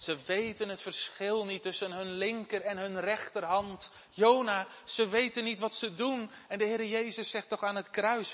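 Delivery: loud, high, urgent preaching; fervent preaching. Acted. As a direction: preach it out to the congregation. Preaching at full force. (luid en vurig preken)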